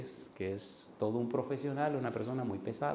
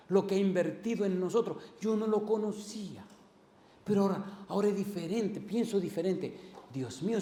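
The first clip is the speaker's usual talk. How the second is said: lightly voiced convert, higher and rising in wonder; a hint of the amazed new believer; grateful former atheist; 6 semitones higher than usual. Former atheist telling the pastor, lightly voiced amazement. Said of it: "Y me decía".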